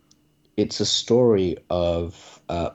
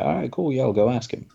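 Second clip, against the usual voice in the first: casual, higher-pitched easy agreement; breezy yes, pitched up; easygoing Moses; 6 semitones higher than usual. Retold or imitated. Moses agrees to ask God, easygoing. Voices Moses in retold parable.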